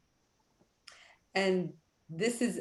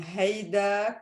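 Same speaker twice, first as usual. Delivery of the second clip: Lebanese-accented haydak, slow "hay" opening. Shown as a demonstration. Teacher comparing regional forms for learners, Lebanese accent. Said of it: "is Lebanese accent".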